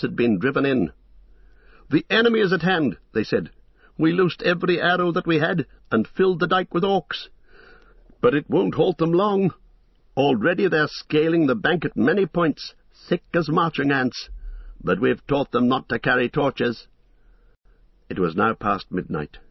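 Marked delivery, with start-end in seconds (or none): 1.9-3.0 high, fast, alarmed warning; urgent report. Acted. Rear guard reports the attack. 4.0-16.9 high, fast, alarmed warning; urgent report. Acted. Rear guard reports the attack.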